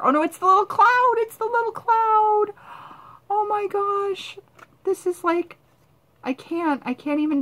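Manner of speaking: high cooing squeal over something cute; excited squeal. Real, not acted. Finding a cloud sticker, she squeals and coos. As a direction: coo like it's the cutest thing ever.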